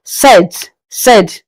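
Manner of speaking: sept said set with silent P; French sept. Shown as a demonstration. Teacher repeating sept with silent P. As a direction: drop the P in sept. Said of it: "don't pronounce the P".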